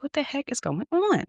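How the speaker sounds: high incredulous question; young woman bewildered by church uproar. Retold or imitated. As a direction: retell your baffled reaction.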